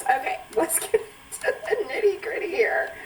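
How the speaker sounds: drawn-out laughing opener; giggly, slowed start. Real, not acted. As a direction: laugh through the kickoff. Opening the show, laughing.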